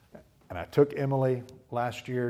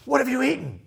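much higher, louder; demanding question; 13 semitones higher, 8 dB louder than usual. Retold or imitated. A dad interrogating his daughter. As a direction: fire it at the child.

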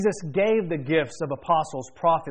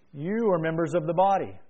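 ordinary delivery

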